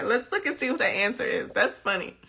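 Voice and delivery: usual speaking voice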